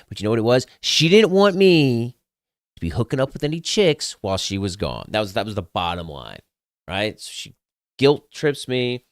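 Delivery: ordinary talk, nothing marked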